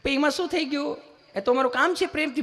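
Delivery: high, soothing nurse voice; sweet nurse. Retold or imitated. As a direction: coax a nervous patient sweetly. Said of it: "સહજતાથી પૂછતા હોય એમ જ પૂછે".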